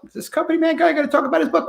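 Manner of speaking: high, quick mock-viewer question; imagined sceptical viewer. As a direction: ask it fast in a put-on high voice. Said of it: "you're thinking to yourself".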